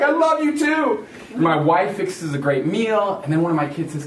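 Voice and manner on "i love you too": high, loud mock-gleeful reply; over-the-top delighted father; gushing dad. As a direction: sing out affection, hammed up. Dad answers kids joyfully.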